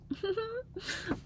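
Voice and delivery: high, cry-like squeal of laughter. Real, not acted. Finding yet another good drawing, squealing laugh.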